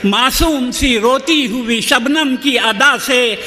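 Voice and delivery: measured, rhythmic mushaira delivery; stately nazm recitation. Acted. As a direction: recite each couplet with gravity.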